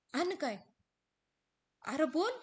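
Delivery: high, loud plea to go on; desperate demand. Acted. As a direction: force the rest out. हिरा ओरडते. (आणि काय म्हणत तळमळून ओरडणारी हिरा)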